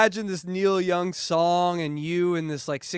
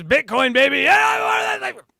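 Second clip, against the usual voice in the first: high excited yell; hyped shout; 10 semitones higher, 7 dB louder than usual. Acted. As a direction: shout it like a crypto bro. Hyped Bitcoin joke, shouting.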